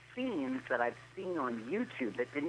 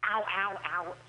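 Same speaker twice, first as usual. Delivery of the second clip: higher pretend yelps, easing down; mock pain; 7 semitones higher than usual. Joking with a friend after a crude bit, mock pain. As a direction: play at being hurt, pleading at the end.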